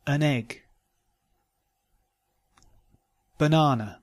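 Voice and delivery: letter A reduced to a short "uh", as in "Banana"; careful model words, unstressed A said "uh" as in "About"; schwa for A. Shown as a demonstration. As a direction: say the weak A in "Canada" as schwa. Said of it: "words where the uh is spelt with the letter A".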